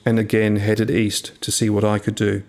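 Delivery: usual speaking voice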